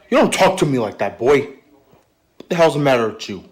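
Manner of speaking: loud fatherly telling-off; angry scolding. Acted. Father scolds cheeky son.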